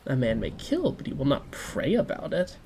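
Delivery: ordinary delivery, nothing marked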